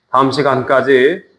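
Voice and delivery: ordinary delivery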